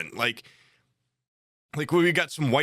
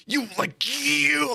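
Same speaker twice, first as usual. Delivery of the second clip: sputtering anger; 12 semitones higher, much slower than usual. Retold or imitated. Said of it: "he couldn't even argue with us".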